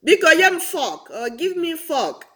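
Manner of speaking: high-pitched request with fork as "fawk"; Nigerian pronunciation. Retold or imitated. Nigerian party guest asking for cutlery, fork as "fawk". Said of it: "you hear the word".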